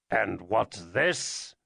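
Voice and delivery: slow, low, ominous question; slow menacing demand. A narrator's reading. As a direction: lean on each word with menace. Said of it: "Ferguson demanded ominously".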